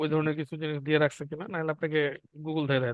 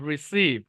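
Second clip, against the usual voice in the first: Receive with strong, falling SEEV; quick ri, then land on SEEV in receive; stress on second syllable; 2 semitones lower, much slower than usual. Shown as a demonstration. Teacher repeating for students, receive stressed on SEEV. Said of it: "এখানে স্ট্রেসটা যাবে".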